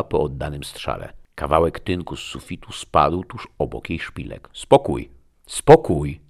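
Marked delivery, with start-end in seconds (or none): 4.5-6.2 loud, repeated command; forceful shout for calm; shouted order. A narrator's reading. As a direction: call the room to order, second time louder. Calling fighting men to order.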